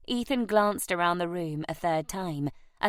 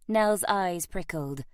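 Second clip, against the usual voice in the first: ordinary delivery, nothing marked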